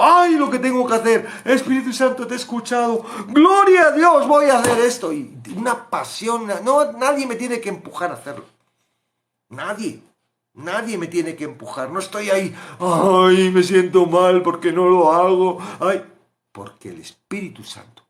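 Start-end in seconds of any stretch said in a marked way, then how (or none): 0.0-5.1 high, loud glad exclamation; joyful eagerness. Retold or imitated. Joyful reply to the Spirit. 12.8-16.0 mock guilty whine. Mocking guilty complaint.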